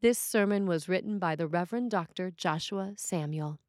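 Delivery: ordinary talk, nothing marked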